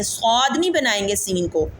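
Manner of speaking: ordinary talk, nothing marked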